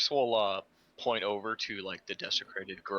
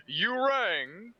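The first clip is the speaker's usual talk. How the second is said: high-pitched mock servant reply; theatrical answer to summons; 12 semitones higher, 4 dB louder than usual. Tiras appears immediately to answer the summons, theatrical high reply.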